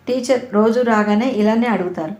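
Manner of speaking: ordinary delivery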